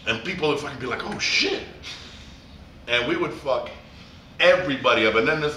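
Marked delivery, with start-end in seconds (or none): 1.0-1.6 high-pitched awed exclamation; mimicked astonishment, voice shooting up; amazed crowd. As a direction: imitate an audience blown away.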